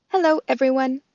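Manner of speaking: robot voice. Acted. Roz the robot speaks.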